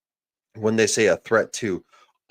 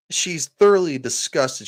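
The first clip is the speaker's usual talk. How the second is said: worked-up remark; 7 semitones higher than usual. Real, not acted. Streamer ranting about the reporter.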